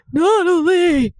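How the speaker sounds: put-on high, loud old man cry. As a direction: shout the name like an old man. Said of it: "He says it like, like an old man".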